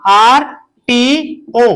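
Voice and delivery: very slow, each letter stretched: MLA; slow spelling out. Shown as a demonstration. Teacher spells abbreviation for children, stretched slowly. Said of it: "drawn out for children".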